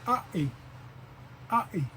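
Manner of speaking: ae as ah, then a catch, then e; glottal ae. Shown as a demonstration. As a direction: ae split ah then e. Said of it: "Now it's the word".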